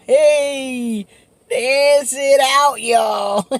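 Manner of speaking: a high, loud, drawn-out cheer to get everyone dancing; party cheer calling everyone to dance; cheering shout. Real, not acted. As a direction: whoop it out to the crowd.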